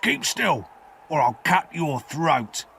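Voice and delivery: convict's menacing order, starting high; terrible threatening voice. A narrator's reading. Convict seizes a boy by the neck, menacing threat. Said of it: "cried a terrible voice".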